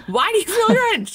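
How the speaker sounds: high-pitched incredulous blurt, amused; stunned question. Retold or imitated. Mom reacting to her son's surprising answer, stunned. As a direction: ask it in high, baffled disbelief.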